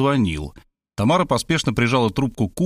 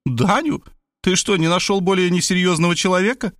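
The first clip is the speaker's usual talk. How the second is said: high, scornful disbelief; a touch of incredulous mockery; surprised; 7 semitones higher than usual. A narrator's reading. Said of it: "Она подпрыгнула на диване".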